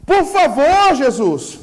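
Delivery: anguished cry for the Lord to open; pleading virgins. Acted. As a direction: plead like a bride locked out. Foolish virgins begging at door.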